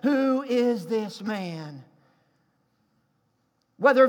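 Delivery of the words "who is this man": drawn-out, weighty refrain, slowly; slow dramatic question. Acted. Preacher to congregation, slow weighty question. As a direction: let the question hang slowly.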